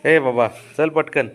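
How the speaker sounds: drawn-out loud call to hurry up. Real, not acted. Owner calls the dog to hurry, loud drawn-out call. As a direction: call the dog over loudly and slowly.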